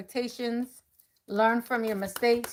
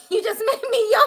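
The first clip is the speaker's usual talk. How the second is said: high, louder, laughing through the line; 13 semitones higher, 6 dB louder than usual. Real, not acted. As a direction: giggle it out helplessly. Yawn contagion laughing.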